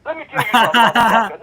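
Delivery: loud, furious outburst at the caller; angry yell. Acted. Yelling furiously at the bill collector on the phone. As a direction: bellow it right down the phone.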